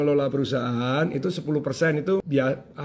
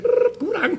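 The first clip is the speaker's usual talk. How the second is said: much higher rolled rrrr, then the machine's verdict; machine whirr; 14 semitones higher, slower than usual. Retold or imitated. Showing the folded bills fooling the counter, high whirr. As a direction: play the bill counter whirring then stopping short.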